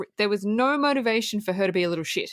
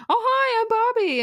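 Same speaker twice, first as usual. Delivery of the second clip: high, loud, sugary cheerful greeting; mock Barbie voice, much higher and brighter; bubbly Barbie; 14 semitones higher, 4 dB louder than usual. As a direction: chirp it like a beaming doll. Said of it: "she's being so positive and so happy and so smiley".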